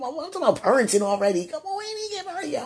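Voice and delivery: fast, high-pitched embarrassed outburst; flustered dismay. Real, not acted. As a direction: whine it out quickly, appalled at yourself. Mock dismay, praying about herself.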